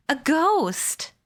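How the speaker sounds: high, scared cry; boy spotting a ghost, crying out in fear; frightened cry. A narrator's reading. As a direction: yelp it out in terror. Boy terrified of invisible voice.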